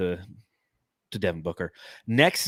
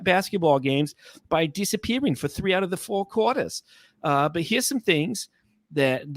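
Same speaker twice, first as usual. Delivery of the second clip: lightly put-on older New York lady accent, pitched higher; 4 semitones higher than usual. Reading Knicks subreddit comments to the co-host in a light older New York lady accent. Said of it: "like an older New York lady".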